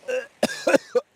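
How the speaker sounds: hacking cough after swallowing a fly; harsh cough. Real, not acted. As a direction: cough it up hard. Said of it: "I just swallowed a fly".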